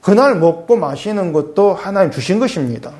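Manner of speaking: ordinary talk, nothing marked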